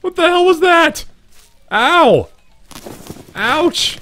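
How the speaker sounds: high, loud shocked yell; startled scream. Real, not acted. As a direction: shriek at the sudden blast. Sudden explosive shot hits.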